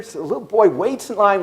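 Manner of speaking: ordinary delivery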